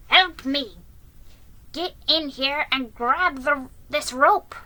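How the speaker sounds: barked order. A narrator's reading. Jiggy struggling with the rope, barking at Ben. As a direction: bark it at a helper. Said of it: "glared at Ben and barked".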